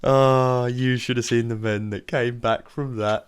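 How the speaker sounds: slow words on the edge of laughter; hammed-up amusement, barely holding it in; cracking up. Boss recalls his returning men, slowly cracking up. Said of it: "breaks down in uncontrollable laughing".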